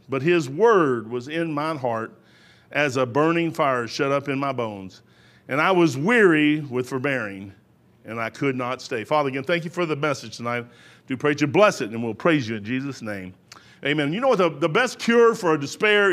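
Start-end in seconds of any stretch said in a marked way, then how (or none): 0.1-9.0 low, measured Bible reading; weighty, lowered reading voice; solemn scripture reading. A narrator's reading. Reads scripture aloud, slow and low. 9.0-14.1 low, softened prayer voice; hushed closing prayer; real prayer. Real, not acted. Prays aloud.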